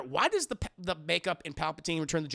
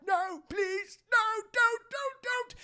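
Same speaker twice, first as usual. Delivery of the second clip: very high, panicky villain pleading; mock begging; 3 dB louder, slower than usual. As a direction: squeal for mercy, hammed-up. Mimics Palpatine begging for life.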